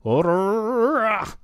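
imitating a rolled, growled R: Orrrrrrah. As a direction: trill the R in Orrrrrrah like a metal singer. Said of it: "when he rolls his R's".

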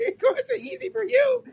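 can't get it out for laughing. Real, not acted. Mocking the absurd claim, laughing hard and high.

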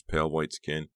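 ordinary delivery, nothing marked

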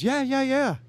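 high, rushed yeahs from a frightened boater; mock nervous boater voice; panicked boater. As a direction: answer fast and high like a nervous stranded guy. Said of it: "And he goes".

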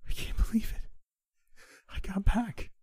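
whispery disbelief leaping to a high squeal; amazed excitement. A narrator's reading. Girlfriend who got back into the dream, amazed. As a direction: gasp it, then pitch right up in delight.

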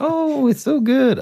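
high, slow put-on voice; hammed-up high drawn-out relish; squeaky slow show of delight; mock delighted eater. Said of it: "and be like".

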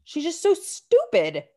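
stretched-out, fed-up outburst; exasperated. Real, not acted. Exasperated venting about Jody.

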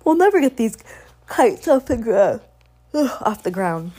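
put-on a girl's exasperated whine; frustrated girl. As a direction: grumble that the kite won't fly. Kite won't fly, frustration.

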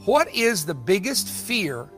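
usual speaking voice

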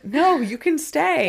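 high, loud, overeager insistence; mock-desperate, pitch way up; urgent pleading. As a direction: insist in a hammed-up frantic plea. Rory insisting Paris stays.

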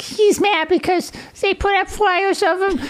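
high, girlish mock voice; impersonating the little sister tattling. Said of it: "Sister's like".

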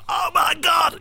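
high, excited commentary cry; animated commentator shout. Retold or imitated. Commentator shouting over a late winner. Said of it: "brilliant Capital Radio days of being, like, animated and going for it".

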